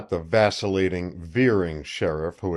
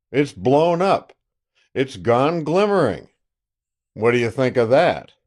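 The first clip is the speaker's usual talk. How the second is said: loud, boastful country sheriff on the phone; a gloating sheriff calling an old friend; triumphant sheriff; 4 semitones higher, 5 dB louder than usual. Acted. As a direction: crow over the news like a self-satisfied lawman.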